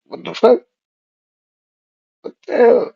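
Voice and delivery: sudden high, startled outburst; shocked disbelief. Retold or imitated. Seeing a ghost girl in the field, high startled disbelief. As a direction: jump up high in pitch, startled. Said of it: "I'm like".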